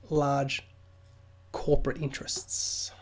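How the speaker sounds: hushed whisper on the last words; whispered aside. Real, not acted. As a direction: drop to a secretive whisper. Conspiratorial aside on corporations.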